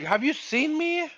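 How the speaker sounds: high-pitched mock disbelief; incredulous retort. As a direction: retell it as a squeaked, astonished question. Retells own incredulous retort.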